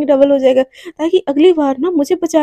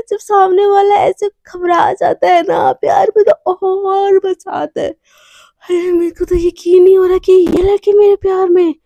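delighted swooning; 2 semitones higher than usual. Acted. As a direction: stretch it out, relishing the conquest. Swooning alone after love discovery.

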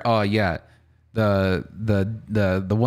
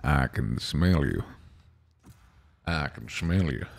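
Morgan Freeman impression; 7 semitones lower than usual. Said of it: "Oh, like Morgan Freeman".

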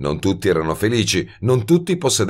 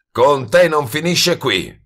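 slow, raised growl over the shoulder; ringhiando; 8 semitones higher, 4 dB louder, slower than usual. A narrator's reading. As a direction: growl it as a parting threat, slowly. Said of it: "ringhiò di sopra la spalla". (ringhio minaccioso, lento)

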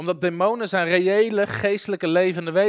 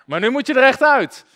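higher, louder command to leave; raised voice casting out a demon; 7 semitones higher, 8 dB louder than usual. Retold or imitated. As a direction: order the demon out firmly.

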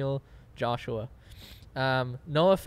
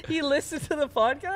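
much higher, laughing through the question; laughing disbelief; 13 semitones higher, 3 dB louder than usual. Real, not acted. Host amazed the tall guy listens to their podcast, laughing disbelief.

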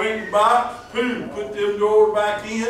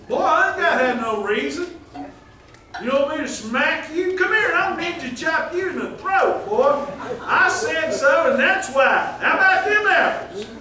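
hammed-up scolding dad, higher and louder; mock angry parent; 7 semitones higher, 4 dB louder than usual. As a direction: play a blustering father threatening his boy, half joking. Parent voiced scolding kids.

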